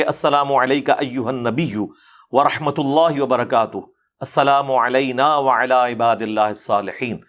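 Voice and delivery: drawn-out devotional Arabic phrases; Arabic prayer recitation. Acted. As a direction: recite the salutation slowly and evenly.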